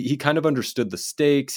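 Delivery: ordinary talk, nothing marked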